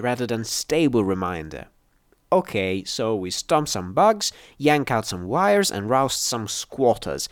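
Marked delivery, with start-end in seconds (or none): none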